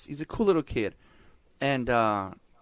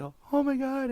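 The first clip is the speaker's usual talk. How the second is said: excited squeal; 11 semitones higher than usual. Retold or imitated. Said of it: "she'll be like".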